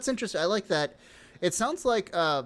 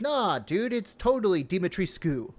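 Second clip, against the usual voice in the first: higher-pitched dude-ish contradiction; mock insistent fans; 6 semitones higher than usual. Quoting Romanian fans who saw the game, mock insistent. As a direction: lightly mimic a fan insisting.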